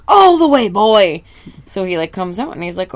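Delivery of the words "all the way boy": much higher, much louder boss bellow; bossy yell. Acted. Boss shouting at his cook to come all the way out. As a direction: bark it like a furious old boss.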